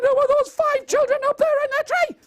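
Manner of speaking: very high, fast squeal; shocked panic. Acted. Stan seeing five children in the tree, high fast panic. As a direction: gabble it out at the top of your voice.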